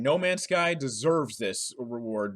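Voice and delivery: ordinary talk, nothing marked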